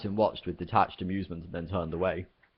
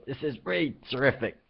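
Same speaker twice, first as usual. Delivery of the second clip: sarcastic; 6 semitones higher than usual. A narrator's reading. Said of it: "spluttered Arthur".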